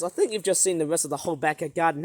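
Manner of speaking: usual speaking voice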